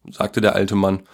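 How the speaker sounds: ordinary delivery, nothing marked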